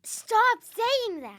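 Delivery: loud, slow, exasperated; fed up. Real, not acted. Sister fed up with slang.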